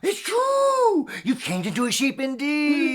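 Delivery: very high gasping wonder; mock astonishment. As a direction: gasp and cry out in wonder.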